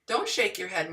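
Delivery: ordinary talk, nothing marked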